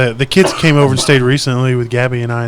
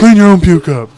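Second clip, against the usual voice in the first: loud, higher-pitched scolding order; exasperated yell; 5 semitones higher, 6 dB louder than usual. Retold or imitated. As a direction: lay down the law to the kid. Retold order to his kid.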